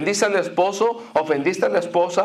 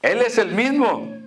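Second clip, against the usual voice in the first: raised, fervent preaching; 5 semitones higher than usual. Acted. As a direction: proclaim it high and strong. Preacher raising voice on God's constancy. (predicando con fervor)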